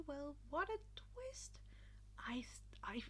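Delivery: soft unvoiced whisper. Real, not acted. Reader whispering an aside to listeners, whisper. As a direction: whisper it confidingly.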